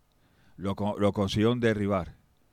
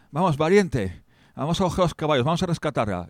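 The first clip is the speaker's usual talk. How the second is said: eager rally; 6 semitones higher, 5 dB louder than usual. Acted. Rallying companion.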